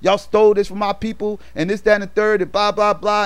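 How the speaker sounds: high-pitched retold rant, lightly acted; going ham. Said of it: "he started going ham on her".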